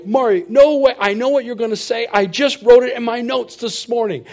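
eager to beat her to the punchline; excited outburst. Retold or imitated. Answering his wife Mari in an excited, high, loud rush.

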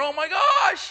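high, loud alarmed cry; panicked dad. Retold or imitated. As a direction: yelp it in horror. Panicking during forceps delivery.

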